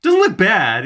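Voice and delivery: a hammed-up reluctant compliment; mock grudging approval. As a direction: act impressed despite yourself. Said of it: "you're like".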